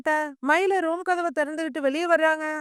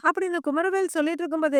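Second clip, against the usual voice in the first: usual speaking voice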